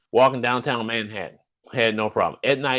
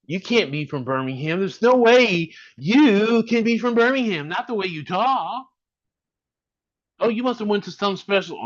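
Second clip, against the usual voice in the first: mock disbelief; 9 semitones higher than usual. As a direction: stretch it out in mock disbelief, pitched up. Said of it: "there are people down here like".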